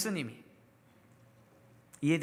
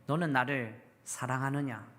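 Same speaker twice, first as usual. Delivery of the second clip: lower, softer, tender questioning; Jesus gently asking Peter, soft and lower; gentle question; 7 semitones lower, 3 dB quieter than usual. Acted. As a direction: ask it as a gentle restoring voice. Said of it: "온화한 태도로 베드로에게 질문합니다".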